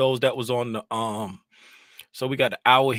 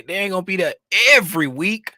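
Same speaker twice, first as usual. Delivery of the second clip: ordinary talk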